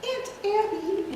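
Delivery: a lightly voiced elderly aunt; Aunt Abby voice. Shown as a demonstration. Introducing the cast, a sweet old aunt. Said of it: "take several characters".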